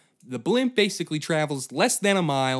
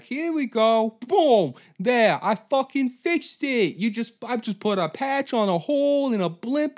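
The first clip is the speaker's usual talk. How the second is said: much higher, louder boastful cartoon voice; cocky fixer; 12 semitones higher, 7 dB louder than usual. Lou bragging loudly about patching his blimp. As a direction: crow over a lousy patch job. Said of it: "just being like".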